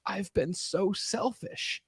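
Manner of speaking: soft, slow, hushed admission; hushed acted remorse; retold tearful confession. Tearful confession to his wife, retold.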